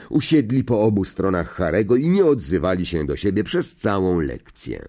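ordinary delivery

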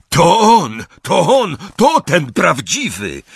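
high, loud alarm shout; excited yelling over the noise; cutting through engine roar. A narrator's reading. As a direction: scream the discovery to your allies. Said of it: "Głos zakapturzonego śmierciożercy przedarł się przez ryk silnika".

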